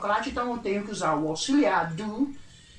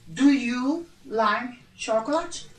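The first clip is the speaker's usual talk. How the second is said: slow, clear classroom question; slow model question; much slower than usual. Shown as a demonstration. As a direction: model the question slowly for students. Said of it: "a pergunta vai ficar". (pergunta modelo, devagar)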